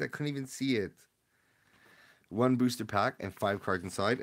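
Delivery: ordinary talk, nothing marked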